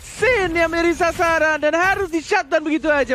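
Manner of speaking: esports caster. Acted. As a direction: push the excitement up. Co-caster hype call. (komentator esports bersemangat)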